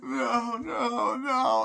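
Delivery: very high, loud wailing; acted hysterical cries; imitated children screaming. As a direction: wail like terrified children. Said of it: "the children are there like".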